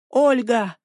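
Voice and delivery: understated chasing call. A narrator's reading. Boy calls after running girl.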